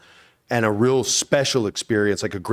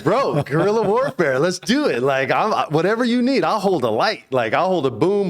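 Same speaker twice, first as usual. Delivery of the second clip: retold eager enthusiasm; 9 semitones higher, 4 dB louder than usual. Eager reply to the director.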